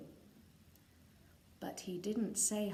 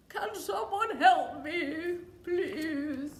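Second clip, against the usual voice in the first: louder lion plea; poorly lion; 5 semitones higher, 6 dB louder, slower than usual. Acted. Sick lion asking the doctors for help. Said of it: "he said".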